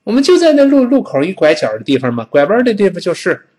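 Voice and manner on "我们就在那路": ordinary talk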